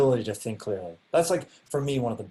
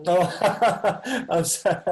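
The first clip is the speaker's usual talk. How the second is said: higher, louder, breaking into laughter; laughing apology; 6 semitones higher, 6 dB louder, much slower than usual. Real, not acted. Teacher apologizing to a class participant over a name, laughing.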